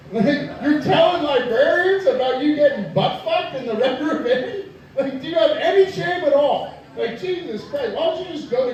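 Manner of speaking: incredulous laughing. Real, not acted. Incredulous rant at Megan.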